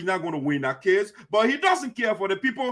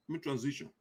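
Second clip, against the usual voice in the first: much softer, said under the breath; soft repeat; 5 semitones lower, 15 dB quieter, faster than usual. Real, not acted. Host murmuring to himself before the next topic, softly. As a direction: drop it to yourself.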